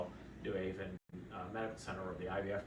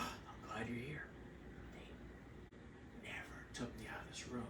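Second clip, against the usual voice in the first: whispered confiding; 12 dB quieter than usual. Retold or imitated. Sedated wife whispering to husband, hushed. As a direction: tell your husband the secret quietly. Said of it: "she turned to her husband and go".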